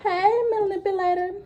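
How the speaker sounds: high sing-song call, stretching the hey; mimicking her call. Storyteller mimicking the girl who hailed her boyfriend, high sing-song. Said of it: "So she like".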